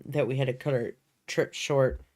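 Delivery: normal talking voice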